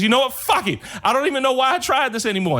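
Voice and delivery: hammed-up despairing quitter; exasperated giving up. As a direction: throw up your hands in despair. Joe panics and gives up.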